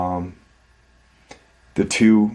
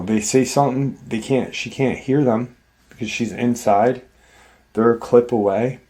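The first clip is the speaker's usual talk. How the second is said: usual speaking voice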